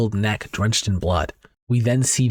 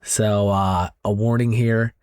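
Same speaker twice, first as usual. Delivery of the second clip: ordinary delivery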